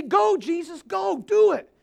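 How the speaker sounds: loud, high, excited cheer; urging prophet. Acted. Preacher as Isaiah cheering Jesus on, excited shout. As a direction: urge him on like a thrilled onlooker. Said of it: "It's like he's watching and seeing".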